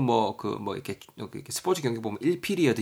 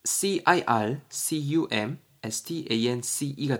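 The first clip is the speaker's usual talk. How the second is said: each letter spoken slowly and separately; spelled out; much slower than usual. Shown as a demonstration. Teacher spelling circumstance for students, slow and deliberate. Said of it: "circumstance가 뭐냐면".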